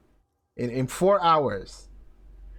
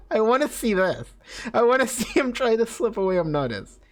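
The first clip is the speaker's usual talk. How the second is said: laughing; 8 semitones higher than usual. Real, not acted. Laughing at his game character.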